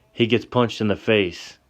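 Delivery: ordinary talk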